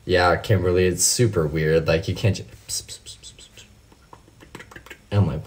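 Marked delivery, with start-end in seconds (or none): 2.7-3.6 very soft whispered "pss" noises; mock an ASMR whisperer; string of tiny whispered hisses; imitated mic whispering. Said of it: "people whispering into their mic".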